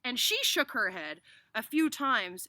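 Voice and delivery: high, loud, slow storytelling; animated, emphatic read-aloud; excited story narration. A narrator's reading. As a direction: stretch out the cheering for young listeners.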